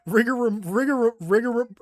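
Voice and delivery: giggling word stumble; laughing stumble. Real, not acted. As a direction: keep failing the word while cracking up. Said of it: "I can't even say it now".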